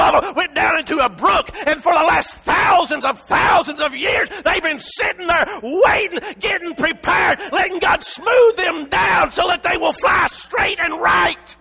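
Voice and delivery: shouted, high preaching cry; full-force sermon shout; fiery preaching. Acted. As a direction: preach it to the rafters.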